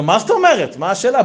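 high, loud, incredulous questions; emphatic surprise. Acted. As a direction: answer as if it were obvious. Rebbe answers the question instantly.

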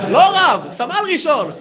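high-pitched emphatic retort; mock-indignant retort; acted loud correction. Retold correction of the rank.

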